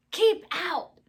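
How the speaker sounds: high, loud warning call; keep out shout. A narrator's reading. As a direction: shout it like a stern sign.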